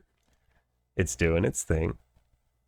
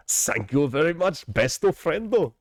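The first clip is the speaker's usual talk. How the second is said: higher anime voice, stretched ending; anime character impression; 6 semitones higher than usual. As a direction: give it the cartoon lilt. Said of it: "it's from J J K".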